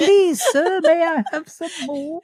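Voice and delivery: high pleading orphan voice; Oliver Twist voice. Acted. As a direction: beg like a Victorian orphan. Oliver Twist quote after British accent teasing.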